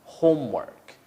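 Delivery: homework with stress on home and a falling end; model pronunciation. Shown as a demonstration. Teacher modelling homework for students to repeat. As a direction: model homework clearly for repetition. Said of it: "and one last time".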